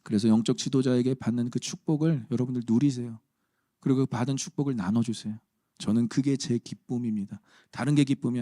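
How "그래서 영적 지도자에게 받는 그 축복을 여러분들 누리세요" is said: normal talking voice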